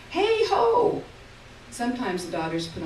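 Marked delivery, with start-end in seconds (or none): 0.1-1.0 put-on high, loud call from an old mother; a frail old mother perking up and calling out; old Ma's call. A narrator's reading. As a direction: call out to her daughters like an old woman. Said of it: "she says".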